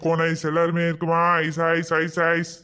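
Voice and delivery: low, monotone ice-seller patter; street vendor's call. Acted. Ice seller touting to the festival crowd, sing-song vendor call. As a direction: chant the wares like a hawker.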